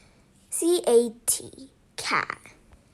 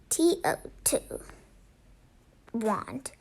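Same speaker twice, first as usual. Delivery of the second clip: plain reading voice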